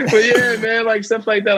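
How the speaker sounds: high laughing voice. Real, not acted. High laughing words. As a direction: guest laughs off his Australian accent. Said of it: "laughing after accent attempt".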